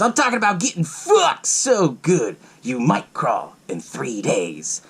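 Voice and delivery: slow, heavily stressed acted boast; intense close-up pitch. Retells man grabbing Zeke, close up.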